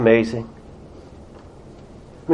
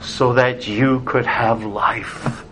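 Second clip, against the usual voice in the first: slow, softer and lower, tenderly reassuring; tender father; 6 semitones lower, 5 dB quieter, slower than usual. Retold or imitated. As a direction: let each word settle, quietly and slowly, like a father to his child. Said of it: "the Father coming to you and saying".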